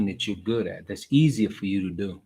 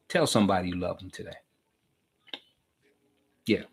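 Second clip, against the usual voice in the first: ordinary talk, nothing marked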